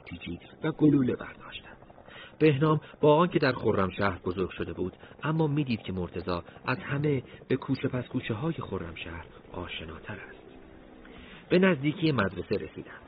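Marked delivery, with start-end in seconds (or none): none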